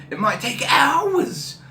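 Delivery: slow, high mock-ominous; dramatic. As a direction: stretch it out dramatically. Dramatic aside to viewers.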